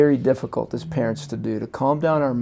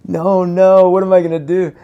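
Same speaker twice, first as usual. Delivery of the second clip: higher, louder mock panic; 7 semitones higher, 10 dB louder than usual. Dad caught not listening to daughter, loud mock panic. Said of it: "was going".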